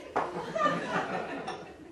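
airy high giggle; breathy high laugh. Real, not acted. Narrator giggling at the imagined neon sign, breathy. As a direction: let a laugh escape.

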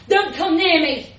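loud, high desperate bellow. A narrator's reading. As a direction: bellow it over the wind. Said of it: "John bellowed at the policeman".

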